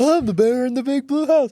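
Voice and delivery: high silly cartoon bear; goofy kids' show bear. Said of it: "sound more like a Barney character".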